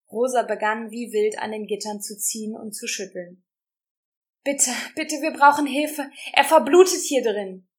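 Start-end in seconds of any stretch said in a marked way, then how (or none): 4.4-7.7 high, loud pleading cry; desperate pleading. A narrator's reading. Rosa begging the guards for help, rising panicked plea.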